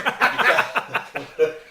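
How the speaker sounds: a sudden loud burst of laughter. Real, not acted.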